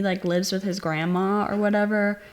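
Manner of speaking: ordinary talk, nothing marked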